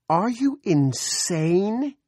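high, slow, disbelieving scream. Acted. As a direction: shriek it at him. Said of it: "Carolyn screamed".

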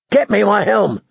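high, forceful growled command; growled order. A narrator's reading. Warrior demanding his helm from the girl, growled. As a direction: bark it out as a rough order. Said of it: "Clegane growled at her".

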